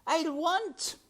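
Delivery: high, loud, tearful wail; sobbing. A narrator's reading. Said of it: "sobbed Meg".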